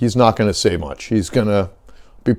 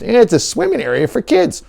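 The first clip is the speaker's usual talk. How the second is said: high, nasal-free mock whine, much higher; mocking whiny voice; 12 semitones higher than usual. Mocking a tourist who fakes a swim zone, high whine. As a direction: sneer it like a dumb tourist.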